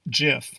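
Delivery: gif pronounced "jif", G as in gem; soft G. Shown as a demonstration. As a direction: start gif with a J sound. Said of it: "Proper pronunciation is gif".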